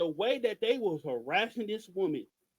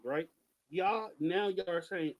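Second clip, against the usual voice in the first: usual speaking voice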